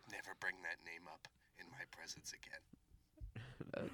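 hushed, low and threatening; menacing whisper. Acted. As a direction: whisper it like a dark warning. Dramatic threat in improv scene.

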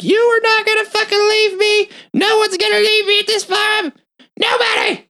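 shrieking, very high-pitched imitated rage; full crazy screaming. Pearl to the man leaving her farm, crazed screaming. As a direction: scream it like someone completely unhinged. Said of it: "she fucking goes full crazy on him".

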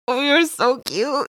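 slow high cooing over a cute thing. Retold or imitated. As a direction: coo it slowly as at a puppy. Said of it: "I was like".

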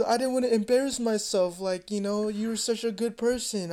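high-pitched imitation of her excuse; mock pleading girlfriend. Girlfriend explaining herself to him, mock pleading.

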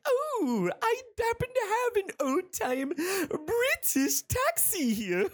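very high, drawn-out, giggly falsetto; Pops. Acted. Groundskeeper offers his old British taxi, giddy high falsetto. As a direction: trill it in a silly cartoon falsetto, ending in giggles. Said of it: "That's what he sounds like".

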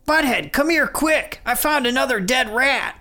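high, frantic cartoon teen yell; Beavis yelling. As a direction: call out in a giddy panic.

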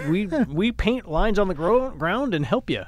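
high, stammering mock protest; baffled state trooper. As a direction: play a bewildered trooper pleading out of his depth.

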